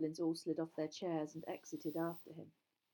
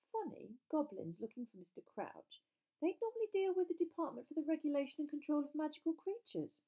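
higher, thoughtful know-it-all; studious schoolgirl; 10 semitones higher, 3 dB louder than usual. A narrator's reading. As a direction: puzzle it out in a higher girlish voice.

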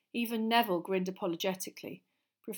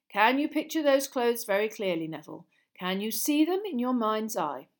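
lively, louder classroom lecture; lightly voiced teacher; 3 semitones higher, 8 dB louder than usual. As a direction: project like an encouraging teacher. Voices teacher coaching pupil.

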